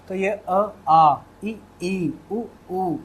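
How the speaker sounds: teacher contrasts short and long vowels; vowel length demo. Shown as a demonstration. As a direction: draw out each long vowel. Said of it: "ध्यान दीजिएगा".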